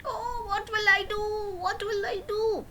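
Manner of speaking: high, breathless whisper of panic; frightened whisper. Acted. Terrified fishmonger panicking as the goblin comes, high whisper. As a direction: whisper it in terror. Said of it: "got really scared".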